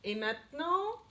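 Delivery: ordinary talk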